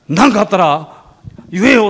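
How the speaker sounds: protective, big-brotherly push; fired-up cop. Retold or imitated. Policeman handing over his card, imitated eager. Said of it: "お巡りさんの方が興奮してる".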